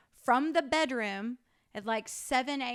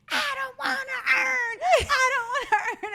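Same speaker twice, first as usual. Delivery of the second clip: mock a child's screamed refusal; imitating screaming toddler; 8 dB louder than usual. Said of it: "Chloe just starts screaming".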